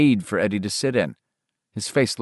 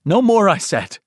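higher, louder, curt mother; curt and final; 9 semitones higher, 5 dB louder than usual. Acted. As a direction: end the argument for good. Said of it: "Her voice was curt and final".

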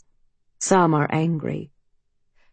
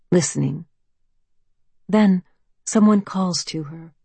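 plain reading voice

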